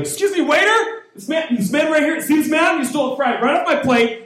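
high shrill-pitched complaining customer; overreacting diner calling the waiter; crazy asshole complaint. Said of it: "I would look like the crazy asshole".

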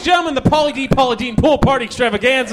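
loud, high mock event announcer; big hammed-up introduction; hype announcer. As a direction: announce it like a party emcee at full volume. Announces an invented pool party.

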